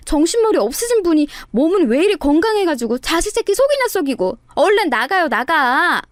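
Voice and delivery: high-pitched scolding of a father; exasperated, understated yell at a wayward parent; mild mock shout. A narrator's reading. Daughter-in-law pretending to scold her runaway father, mild shout. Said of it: "소리쳤습니다".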